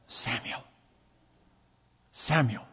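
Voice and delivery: hushed low whispered call; voice of God. Acted. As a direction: call his name softly from the dark. Voice of God calling again.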